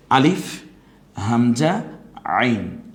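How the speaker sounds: আলিফ loud and rising, then হামজা, then আইন pressed in the throat; Arabic letter names. Shown as a demonstration. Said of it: "আইনটা গলায় চাপ দিয়ে". (গলায় চাপ দিয়ে আইন উচ্চারণ)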